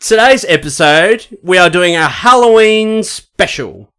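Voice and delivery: slow, announcer intro; show announcement. Acted. Opening the podcast episode, slow announcer read. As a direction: announce the special slowly.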